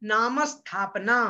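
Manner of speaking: नाम स्थापना slowly, the म joined to the स cluster; teacher models नामस्थापना clearly and slowly; careful slow demo without any i before स्थापना; slow model pronunciation. Shown as a demonstration. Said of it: "मेरे साथ आप लोग बोलिएगा".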